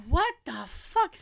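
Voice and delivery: slow, quiet baffled question; startled disbelief. Retold or imitated. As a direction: whisper it, spooked and confused. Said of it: "And I was like".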